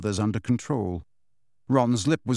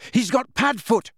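higher, loud desperate shout; loud urgent shout; 10 semitones higher, 4 dB louder than usual. A narrator's reading. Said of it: "he shouted".

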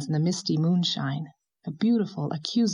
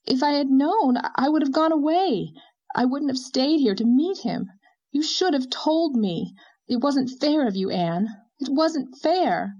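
passionate cry; 8 semitones higher, 3 dB louder than usual. A narrator's reading. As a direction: protest as if deeply wronged. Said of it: "Leslie cried passionately".

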